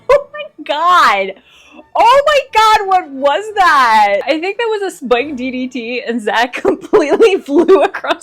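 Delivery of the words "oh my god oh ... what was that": high, loud, drawn-out disbelief; shocked outcry. Real, not acted. Fan reacting to a huge wrestling move, shocked outcry. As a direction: cry out as if floored by what you saw.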